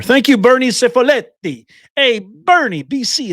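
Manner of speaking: hyped-up live-stream greeting; excited shout-out. Real, not acted. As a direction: call out a big, excited thank-you. Streamer hails a donor arriving.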